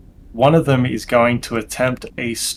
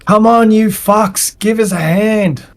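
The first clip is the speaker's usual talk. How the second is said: high-pitched yelled demand; urgent shout for help from outside; yelling; 8 semitones higher, 5 dB louder than usual. Acted. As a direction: yell it through a door, pitched well up. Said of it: "there's yelling. And they say".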